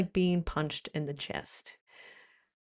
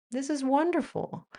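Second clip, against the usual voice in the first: higher-pitched, lightly gushing praise; mock enthusiasm; 7 semitones higher than usual. Acted example of vulgar enthusiasm.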